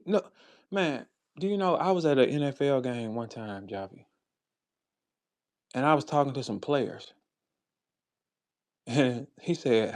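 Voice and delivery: normal talking voice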